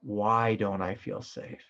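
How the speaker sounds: slow, gentle Why don't I feel safe; slow self-question. Shown as a demonstration. Teacher models self-inquiry for students. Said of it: "a good question is".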